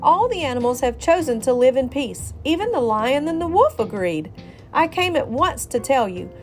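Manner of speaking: high, loud, honeyed pitch; coaxing. Acted. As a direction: coax the rooster down. Fox luring rooster down.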